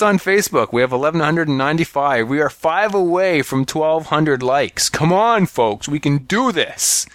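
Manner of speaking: high, eager rallying plea; rally the listeners, upbeat; excited rallying. Real, not acted. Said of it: "rallying listeners for likes".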